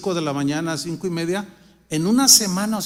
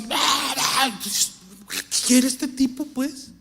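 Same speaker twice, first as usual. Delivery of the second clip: higher, very slow puzzled driver voice; annoyed driver; 6 semitones higher, much slower than usual. Retold or imitated. Mocking other drivers stuck behind him, slow and higher. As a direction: drag it out like a baffled driver. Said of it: "Y luego les pasa así".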